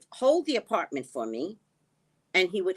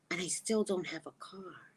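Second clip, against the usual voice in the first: whisper; 8 dB quieter than usual. Real, not acted. Woman on an island without a car, whispered. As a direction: whisper it as if to yourself. Whispered repeat of her complaint.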